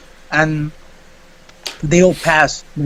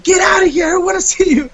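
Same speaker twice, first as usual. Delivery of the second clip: much higher shouted order; mock angry shout; 12 semitones higher, faster than usual. Teacher chasing student from the window, higher shout. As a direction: yell him away like the teacher.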